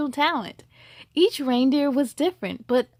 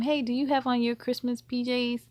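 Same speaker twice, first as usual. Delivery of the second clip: plain reading voice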